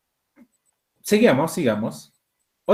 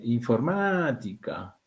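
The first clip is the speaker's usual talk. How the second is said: informática said slowly and a bit softer, each syllable clear; slow, careful word; 4 semitones lower, 5 dB quieter, slower than usual. Shown as a demonstration. Teacher to class, saying informática slowly. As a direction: spell out informática by ear, slowly. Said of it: "Es una palabra esdrújula".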